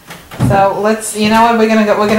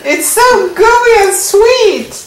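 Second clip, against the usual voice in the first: exaggerated delight; 10 semitones higher, much slower than usual. Mother drawls high and slow.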